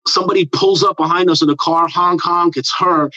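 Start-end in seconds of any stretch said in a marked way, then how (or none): none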